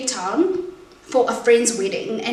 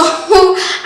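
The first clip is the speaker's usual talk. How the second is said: teary laugh; 8 semitones higher, 10 dB louder than usual. Real, not acted. Laughing through tears.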